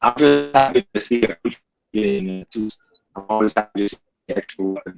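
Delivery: choppy robotic voice, bad connection; glitchy robotic line breaking up. Real, not acted. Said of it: "Sound like a robot".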